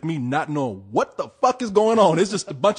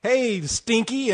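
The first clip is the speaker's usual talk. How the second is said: mock kid Jedi; 6 semitones higher, much slower than usual. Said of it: "a little Jedi say".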